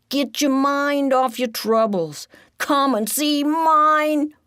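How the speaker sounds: high, loud showman rat calling out; a bouncy high showman's pitch, projected to the crowd; Joey calling from afar. A narrator's reading. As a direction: rat performer hawking his show. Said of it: "everyone hears in the distance".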